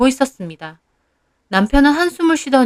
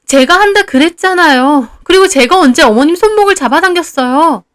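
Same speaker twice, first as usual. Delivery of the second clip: loud defence; 6 semitones higher, 4 dB louder than usual. A narrator's reading. Wife loudly defending herself.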